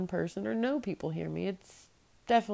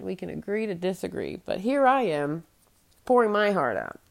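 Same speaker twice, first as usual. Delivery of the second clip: ordinary talk, nothing marked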